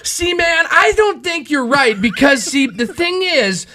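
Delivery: play-acting an argument, pushing back hard; mock heated argument. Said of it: "let's reenact".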